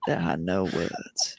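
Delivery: deep, slow commercial narrator; low awed ad voice. Retold or imitated. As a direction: hush it like a spa ad. Echoing the ad line, low slow.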